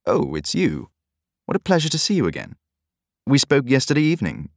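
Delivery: suddenly effusive, eager welcome; effusive benevolence. A narrator's reading. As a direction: greet him with overflowing goodwill. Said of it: "in an inexplicable effusion of benevolence".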